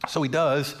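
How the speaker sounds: normal talking voice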